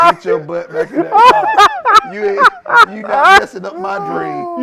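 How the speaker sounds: high, loud slow yelling father; hammed-up yelling dad; mock furious shout. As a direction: yell him back into the car. Dad ordering son back.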